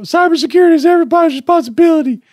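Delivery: high, loud, fast mock slogan. Mocking the boot camp slogan, high and loud. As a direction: rattle it off like a chirpy ad slogan.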